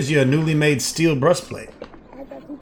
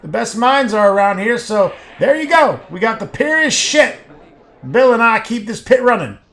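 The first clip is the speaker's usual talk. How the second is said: loud, high-pitched foundry worker; raised, forceful voice of a half-deafened worker; shouting; 6 semitones higher, 5 dB louder than usual. A narrator's reading. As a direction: keep shouting over the noise of the works. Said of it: "He shouts".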